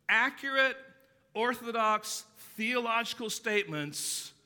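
sarcastic build on accurate, orthodox; punched adjectives. Acted. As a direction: hammer each word of the list. Listing empty correct words.

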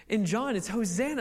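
usual speaking voice

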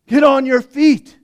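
Jesus calling the listeners, loud and high; loud preaching call. Acted. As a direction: call it out from the pulpit. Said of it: "He says".

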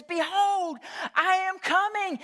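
highest, drawn-out preacher shout, slow; leaning into it. Retold or imitated. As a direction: really lean into the proclamation. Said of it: "he really leaned into it".

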